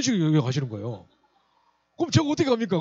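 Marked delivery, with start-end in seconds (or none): 2.0-2.8 high, loud rhetorical protest; exasperated protest. Real, not acted. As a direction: throw the question out, almost crying out. (높고 크게 터뜨리는 하소연)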